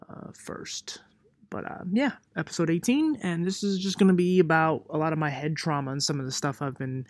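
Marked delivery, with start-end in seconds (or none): 0.1-1.8 whispered aside. Real, not acted. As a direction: drop to a whisper.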